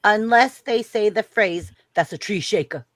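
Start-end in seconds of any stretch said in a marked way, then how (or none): 1.9-2.9 put-on Australian accent. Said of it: "A Crocodile Hunter, just like".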